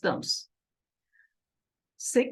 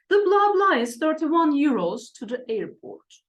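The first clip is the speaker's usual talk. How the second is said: loud first reading; 6 semitones higher, 4 dB louder than usual. Shown as a demonstration. Teacher reads the example aloud, loud and high. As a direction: read it out high and loud, blanks as blah blah. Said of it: "Okay, one".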